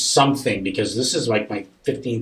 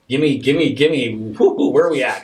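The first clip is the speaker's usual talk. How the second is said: louder, excited rapid-fire pleading; eager urging; 4 semitones higher, 7 dB louder than usual. Retold or imitated. Parent pressing daughter about angels, eagerly excited. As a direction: press for it with a little whoop.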